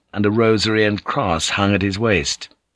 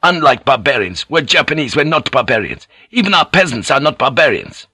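high, emphatic declaration; proud shout; 6 semitones higher, 4 dB louder than usual. A narrator's reading. Joseph defends the Japanese to Alvito, proud raised voice. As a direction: declare it with raised pitch and pride. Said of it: "Joseph shouted".

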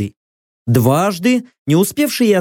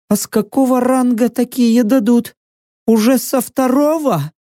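high, meek bleating voice; small high-pitched voice of a cowed survivor; timid bleat; 8 semitones higher than usual. A narrator's reading. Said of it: "один из выживших претов робко проблеял".

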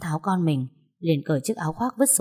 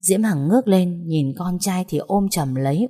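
ordinary delivery, nothing marked

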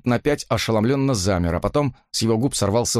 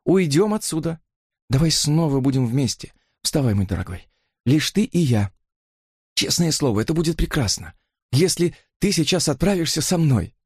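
higher, tender coaxing entreaty; trembling plea; 6 semitones higher than usual. A narrator's reading. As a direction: implore a brother to come along. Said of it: "взмолилась она дрожащими губами". (умоляюще, дрожащими губами)

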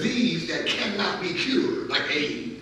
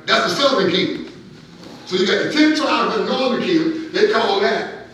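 high, loud sermon delivery; impassioned preaching; 7 semitones higher, 8 dB louder than usual. Real, not acted. Preacher explaining the kingdoms to congregation, impassioned preaching. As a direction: preach it out with force. Preacher lifts into full force.

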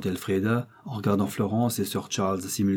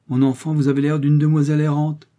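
loud, raised scolding; reproachful; 5 semitones higher, 6 dB louder than usual. A narrator's reading. Uncle scolding a girl who walked out alone, reproachful. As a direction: reprove the child with insistence. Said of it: "avec un ton de reproche".

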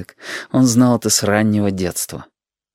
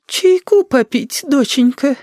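very high, slow, syrupy granny; oily-sweet, drawn-out old lady voice; unctuous; slower than usual. A narrator's reading. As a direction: ooze sweetness as a sly old woman. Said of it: "елейно сообщила бабуля".

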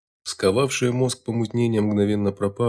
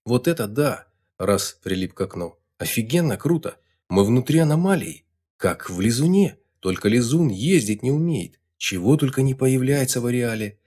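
excited; 4 semitones higher than usual. Acted. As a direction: gush with delight. Amazed boy inside anomaly.

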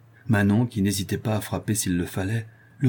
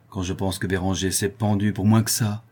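usual speaking voice

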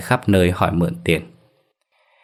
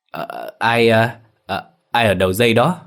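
halting, stammered question with broken starts; narrated father struggling to steady himself; husky, forced calm; 3 semitones higher than usual. A narrator's reading. Father asks an unknown caller, hesitant and shaken. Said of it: "Ông cố trấn tĩnh lại, giọng khàn khàn hỏi".

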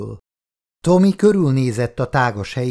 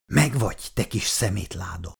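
much softer mutter to himself; 4 semitones lower, 5 dB quieter than usual. A narrator's reading. Tommy cursing the flashlight he caught, muttering softly. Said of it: "motyogta maga elé Tommy".

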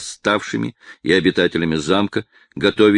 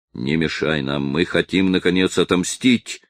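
plain reading voice

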